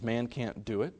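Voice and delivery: ordinary talk, nothing marked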